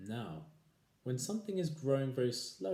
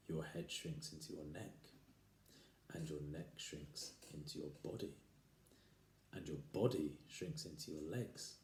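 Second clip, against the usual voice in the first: much softer, creeping menace; spooky hush; 5 semitones lower, 8 dB quieter than usual. Acted. Husband frightening his wife, soft and creeping. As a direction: hush it down like a ghost story.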